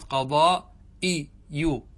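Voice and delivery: قضاء إي يو drawn out, hamza sounded with an i vowel; slow qaḍā'iyu, short i after the hamza; kasra on hamza. Shown as a demonstration. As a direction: say قضاء إي يو slowly as qaḍā-i-yu. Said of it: "الكسرة التي على الهمزة".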